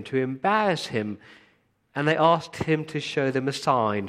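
loud, driving pulpit delivery; fired-up preacher building to the rebuke; full-force preaching. Acted. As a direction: preach it out to the whole room.

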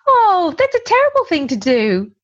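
sweet, overly nice parent tone; high sing-song voice. Shown as a demonstration. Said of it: "I'm not going".

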